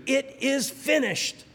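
Jesus on the cross proclaiming victory, triumphant; cry of victory. Retold or imitated. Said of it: "It was a cry of victory".

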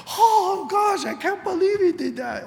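high, shocked exclamation; mock shock. A churchgoer shocked when a brother fails, high mock disbelief. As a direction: act stunned by what someone did. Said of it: "we're all shocked".

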